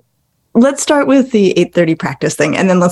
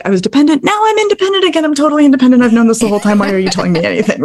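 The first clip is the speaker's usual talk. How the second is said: higher, rushing, hammed-up teen indignation; mock teenage son; 4 semitones higher, faster than usual. Teenage son brushing off his parent, hammed-up indignation. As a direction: play the know-it-all teenager. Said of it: "He's like".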